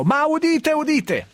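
high, ringing proclamation; town crier. Pretend. Mock proclamation of news.

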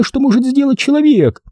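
high, anguished female voice; fearful woman, voiced not whispered; frightened. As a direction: ask in high-pitched horror. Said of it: "испуганный шёпот".

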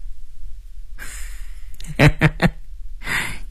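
soft staccato villain laugh; villain's chuckle. Acted. Boss laughs, calm again. (反派轻声冷笑)

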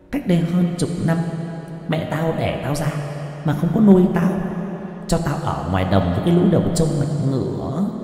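put-on high, fast, aggrieved child's voice; a wronged child ghost pouring out its grievance. Ghost child demands offerings from the family, high aggrieved child voice. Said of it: "nói như là tiếng của một đứa trẻ con".

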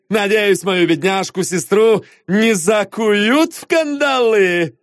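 slow, high, sneering gentleman; high slow theatrical mockery; mocking Anatole. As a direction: drawl your praise with contempt. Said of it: "смех Анатоля".

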